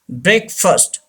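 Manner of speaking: "breakfast" said as BREK-fust; correct pronunciation. Shown as a demonstration. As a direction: say "breakfast" with a short schwa in -fast. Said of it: "The actual pronunciation of this word". ("breakfast" का सही उच्चारण, "ब्रेकफ़स्ट")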